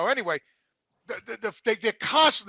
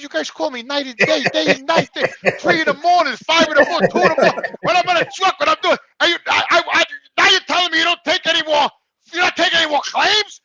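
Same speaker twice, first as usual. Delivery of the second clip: higher and louder, rising outrage; a man yelling at the lawyer's caller, building up; retold angry rant; 6 semitones higher, 6 dB louder than usual. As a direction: tell her off, getting hotter.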